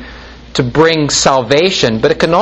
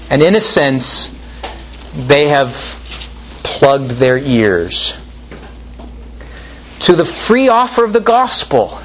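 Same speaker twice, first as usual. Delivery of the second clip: usual speaking voice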